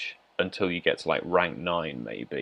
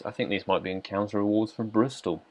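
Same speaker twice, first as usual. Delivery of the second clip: normal talking voice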